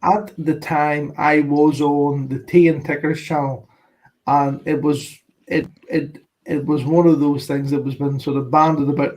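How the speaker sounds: normal talking voice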